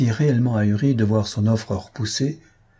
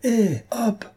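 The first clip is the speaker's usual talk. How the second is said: ringing call; 13 semitones higher than usual. A narrator's reading. Rider hailing the couple from behind, high ringing call. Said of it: "Une voix retentissante lançait derrière un appel".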